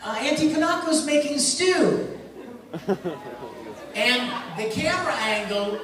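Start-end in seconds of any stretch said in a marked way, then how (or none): 0.0-2.1 put-on high sing-song girl voice, louder; imitating Winry. Retelling Winry's line to the panel, high sing-song. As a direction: chirp it like a sweet young girl. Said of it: "and Winry goes".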